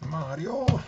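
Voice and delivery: high-pitched Luigi-style cry; Luigi's Mario voice. Acted. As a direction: call out "Mario" high like a cartoon plumber. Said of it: "That's my Luigi Mario voice".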